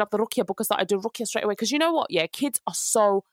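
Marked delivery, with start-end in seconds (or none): none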